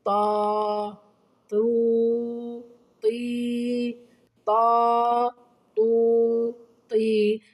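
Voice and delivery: each syllable loud and held on a level note; "طا، طو، طي" with the vowels drawn out evenly; long-vowel demo. Shown as a demonstration. Teacher showing pupils the letter's sound, long-vowel demo. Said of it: "انصتوا إلى نطقه".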